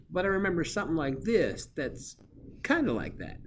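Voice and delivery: emphatic high-pitched pupil imitation; student recalling. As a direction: imitate a kid grasping at a memory. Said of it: "maybe you're gonna do something like this".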